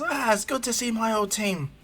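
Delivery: high, beaming voice; happy reunion. Acted. Jiraiya greeting his old team, high beaming voice. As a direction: gush it with glee.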